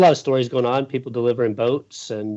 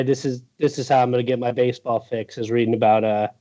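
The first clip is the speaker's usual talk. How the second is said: ordinary delivery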